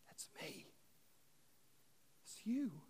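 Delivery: breathy whispered confession; hushed whisper to the congregation. Real, not acted. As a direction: drop to a bare whisper, as if admitting a secret. Confession in the sermon's hush.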